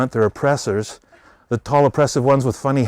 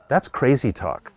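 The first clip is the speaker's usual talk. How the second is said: ordinary talk, nothing marked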